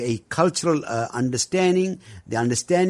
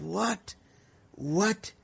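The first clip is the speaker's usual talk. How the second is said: softly voiced, higher, rising disbelief; incredulous; 5 semitones higher, 8 dB quieter than usual. Recalling his reaction to a teacher's philosophy, incredulous. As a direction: question it in quiet, high-pitched disbelief.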